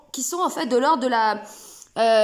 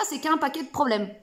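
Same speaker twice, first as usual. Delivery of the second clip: ordinary talk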